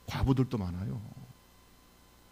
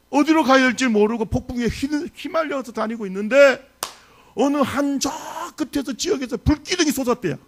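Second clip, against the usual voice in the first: loud, high, driving sermon climax; fervent preaching; 10 semitones higher, 7 dB louder than usual. Acted. Pastor preaches the storm to his congregation, fervently. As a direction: build the storm scene to a peak.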